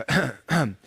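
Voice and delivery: small throat-clearing cough; imitated cough. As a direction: mimic a cough heard on a recording. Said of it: "like, a cough".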